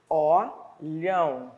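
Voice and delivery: olhão bem devagar, sílaba por sílaba; separado em sílabas. Shown as a demonstration. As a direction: abra a boca em cada sílaba de olhão. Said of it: "eu precisei abrir a boca duas vezes". (olhão dito devagar, sílaba a sílaba)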